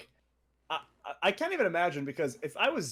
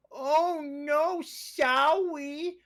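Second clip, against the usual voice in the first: very high, stretched cartoonish voice; high silly character voice; 13 semitones higher, much slower than usual. Mocking his own comedy character for his cohost, high and slow. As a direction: squeak it out like a goofy show character, drawn out at the end. Imitating Sour Boy character voice.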